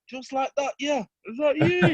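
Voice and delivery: imitating gobsmacked mates in a very high voice; high-pitched, hyped-up mock amazement; astonished friends. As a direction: squeal it like mates in disbelief. Friends on the mic react to news.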